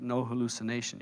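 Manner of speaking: low, soft, matter-of-fact pronouncement; inner divine voice. Acted. Divine voice correcting a doubter, low and quiet. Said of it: "The voice says".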